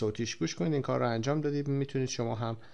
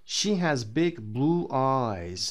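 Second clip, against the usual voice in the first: slow model reading; much slower than usual. Shown as a demonstration. Teacher models book sentence slowly.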